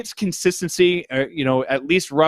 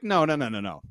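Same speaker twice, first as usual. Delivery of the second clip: usual speaking voice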